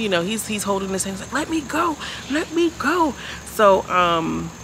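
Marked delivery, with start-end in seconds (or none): 1.3-3.1 much higher, hammed-up pleading voice; mock him straining to break free; mock struggling plea. Mimicking Duke being held back.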